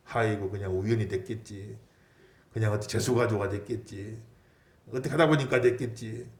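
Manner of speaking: dismissive. Acted. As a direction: wave it away as mere luck, quietly. Voicing dismissive unbelievers. (낮고 시큰둥하게)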